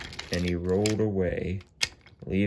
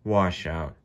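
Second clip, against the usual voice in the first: plain reading voice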